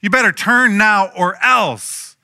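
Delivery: John the Baptist at full force, high and loud; fired-up preaching. Retold or imitated. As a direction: bellow it as a fiery prophet warning the crowd. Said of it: "he is fired up".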